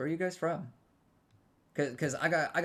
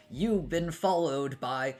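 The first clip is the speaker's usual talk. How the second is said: put-on English line in a slow, louder Spanish accent; louder, slower Spanish-accented delivery; 4 semitones higher, 6 dB louder, slower than usual. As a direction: roll it out con acento like a Spanish announcer. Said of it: "I always say it con acento".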